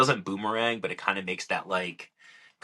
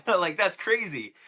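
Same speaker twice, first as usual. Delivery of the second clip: laughing; 9 semitones higher than usual. Real, not acted. Laughing at a ruling he got.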